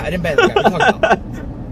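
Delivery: high, loud baffled comeback; mock exasperated protest. Streamer answering chat's ban accusation, mock exasperated. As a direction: protest over the clamor, hammed-up. Acted baffled reply to the mob.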